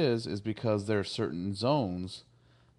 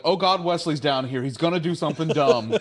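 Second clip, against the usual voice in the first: higher, louder comic worry; mock panic; 5 semitones higher, 5 dB louder than usual. Crew realizes Wesley is down there, panicky. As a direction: imitate a crew suddenly alarmed.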